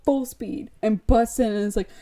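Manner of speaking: normal talking voice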